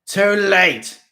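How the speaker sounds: loud high howl. A narrator's reading. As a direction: howl it defiantly. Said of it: "Mister Krebsley howled".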